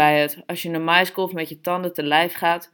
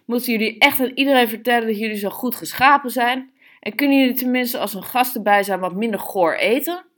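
higher-pitched exasperated scolding; indignant calling out; 6 semitones higher than usual. A narrator's reading. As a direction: scold them with rising indignation. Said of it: "riep ze". (verontwaardigd, met hogere stem)

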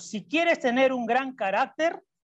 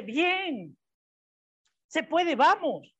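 higher, drawn-out cheering on; encouraging; 4 semitones higher, much slower than usual. Acted. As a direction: imitate a supportive friend cheering someone on. Imita al amigo que anima. (animando, con tono alentador)

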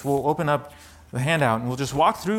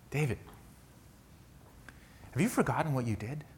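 hushed, needling inner voice; accusing inner whisper; 7 dB quieter, faster than usual. Acted. Preacher voicing David's inner accuser, hushed and needling. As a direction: let it creep in quietly, taunting. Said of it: "a whisper might sound something like".

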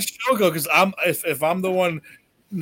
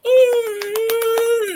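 high wailing ooh; a quick high moan in passing. Pretend. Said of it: "I'll just walk past and go".

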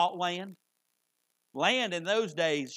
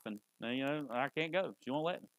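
meek excuse; 6 semitones lower, 9 dB quieter than usual. Retold or imitated. Meek excuse about wife.

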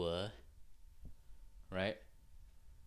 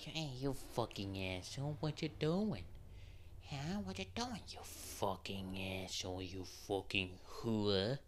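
affectionate dog talk. Shown as a demonstration. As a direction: coo the insults like baby talk to a pet. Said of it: "I do it in a voice in which he can understand my emotions".

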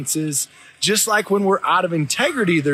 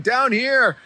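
put-on higher-pitched kid calling up from below; mock holler back; imitated call back; 6 semitones higher than usual. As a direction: imitate a distant answering shout without real volume. Son calls back from below.

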